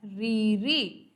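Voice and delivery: रीरी drawn out slowly with a rolled r; slow demo. Shown as a demonstration. Teacher showing where the tongue touches, slow and deliberate. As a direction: stretch रीरी slowly so the tongue tap is heard. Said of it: "next आता है".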